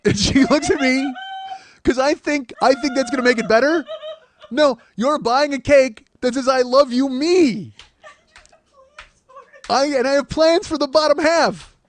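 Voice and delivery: high, loud, laughing exclamation; laughing shout. Real, not acted. Man retells his awkward cake order, laughing shout. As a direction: shout it while cracking up at yourself. Teller cracks up over awkward explanation.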